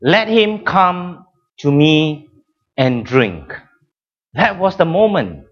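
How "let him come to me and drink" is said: imitating raised voice. Preacher voices Jesus crying out over the noisy worshippers, mildly raised voice. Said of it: "raised his voice and cried out".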